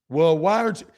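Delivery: normal talking voice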